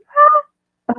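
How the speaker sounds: high, loud fangirl squeal; giddy high-pitched "ah"; excited scream. Acted. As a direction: let out a short thrilled shriek. Overexcited recalling the moment.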